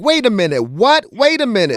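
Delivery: mock outburst of disbelief; retold conniption fit. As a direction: act out stunned disbelief from this morning. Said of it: "I had a conniption fit this morning".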